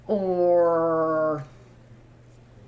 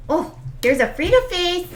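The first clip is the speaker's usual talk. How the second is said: cooing at puppy; 8 semitones higher than usual. Real, not acted. Owner cooing at her puppy's face, high baby talk. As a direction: coo at the dog in a high voice.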